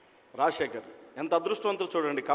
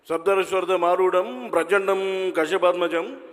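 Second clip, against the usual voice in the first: booming, drawn-out, much louder star speech with a raised pitch; Balakrishna impression; 2 semitones higher, 7 dB louder, slower than usual. Comedian mimics Balakrishna greeting the estate audience, booming and slow. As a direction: thunder it out like a mass hero addressing his fans. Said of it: "Balakrishna గారు ఇక్కడికి వచ్చి మనతో మాట్లాడితే ఎలా మాట్లాడతారు".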